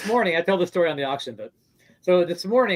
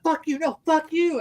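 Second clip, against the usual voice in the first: mock yelling; 10 semitones higher than usual. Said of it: "they started yelling at each other".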